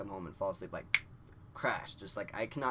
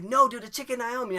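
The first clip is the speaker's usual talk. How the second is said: high, loud correction; raised-voice retold protest; exasperated insistence; 12 dB louder than usual. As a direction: shout it as if nobody understands you. Friend insists on misheard order.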